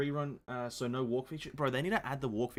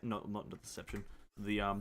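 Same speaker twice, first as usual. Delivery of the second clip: ordinary talk, nothing marked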